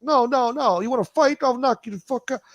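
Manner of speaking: very high, loud hammed-up threat; threatening Cuomo. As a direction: squeal a tough-guy threat. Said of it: "He's like".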